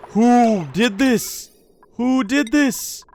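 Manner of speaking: slow, high, drawn-out who did this; mock outrage. Streamer demanding which colonist broke the machine, slow mock outrage. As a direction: wail it out like a wronged parent.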